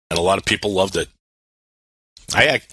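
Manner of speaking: ordinary talk, nothing marked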